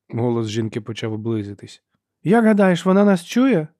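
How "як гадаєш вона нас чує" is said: high, loud taunting question; calling out. A narrator's reading. Sister taunts hidden Ferro, calling to brother. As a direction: make sure the prey overhears.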